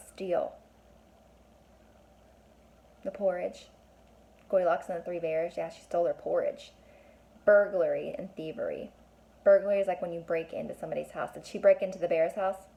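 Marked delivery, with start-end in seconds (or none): none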